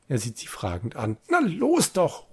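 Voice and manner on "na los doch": high, slow, louder impatience; impatient angry call. A narrator's reading. Scientist rattles the bars, impatient high call. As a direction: drag it out with annoyance. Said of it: "ruft er ärgerlich".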